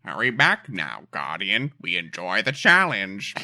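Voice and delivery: low, slow mock alien voice; deep-pitched, deliberate character imitation; Cryptarch, dry. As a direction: draw it out in a put-on dry voice. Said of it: "one of the most dry characters".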